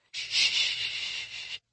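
soft breathy hush; mother hushing her sobbing son. A narrator's reading. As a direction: hush a crying baby. Mother soothing crying toddler.

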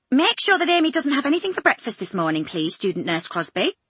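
quick, high, brisk matron orders; brisk ward sister. Acted. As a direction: rattle it off like a busy ward sister.

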